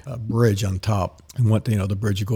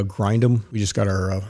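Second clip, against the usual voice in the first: ordinary talk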